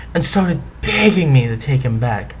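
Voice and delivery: ordinary delivery, nothing marked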